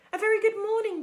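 loud, high cheery hail; friendly booming. A narrator's reading. Robot greets an old goose across the pond, booming and friendly. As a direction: boom it brightly across the water. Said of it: "the robot's friendly voice boomed".

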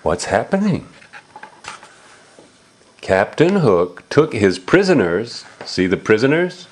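ordinary delivery

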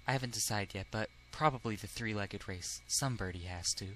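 put-on soft, low, timid boy character; hushed, low classmate voice; mild-mannered classmate. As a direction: explain it very quietly and gently.

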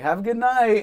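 high, loud mock farewell call; calling after someone. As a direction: call it out to someone walking away. Calling after woman walking away.

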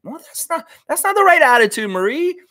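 high-pitched, hammed-up reproach; scandalized mock telling-off; mock scolding. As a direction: chide her in a squeaky put-on voice. Mock scolding of listener's blunt remark.

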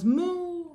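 high playful moo; a sing-song moo for kids; mock cow moo. Animal sound in farm rhyme.

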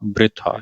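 ਬ੍ਰਿਥਾ begun with a joined br. Shown as a demonstration. Teacher correcting readers, ਬ੍ਰਿਥਾ with r straight after b. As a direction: say ਬ੍ਰਿਥਾ as bri-tha. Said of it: "ਕਿਉਂਕਿ ਰਾਰਾ ਬੱਬੇ ਦੇ ਪੈਰ ਵਿੱਚ ਹੈ".

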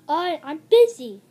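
loud, brusque brush-off; yelling. A narrator's reading. Busy Flynn answers his friend, yelling. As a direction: yell it out as a storybook character. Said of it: "yelled Flynn".

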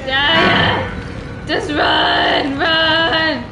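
loud, insistent yelling; urgent shouting. Real, not acted. Player urges the character to run away, shouting. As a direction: shout the command to flee. Urging game character to flee chase.

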